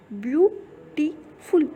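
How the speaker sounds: Beautiful slowly split as view, टी, फुल; syllable by syllable. Shown as a demonstration. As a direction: break beautiful into three drawn-out syllables with clear pauses. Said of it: "धीरे से बोल करके". (Beautiful को धीरे, तीन हिस्सों में रुक-रुक कर)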